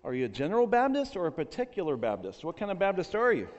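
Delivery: high-pitched, eager acted voice; hammed-up enthusiasm; delighted rescuer. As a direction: gush at finding common ground. Rescuer's growing delight in joke.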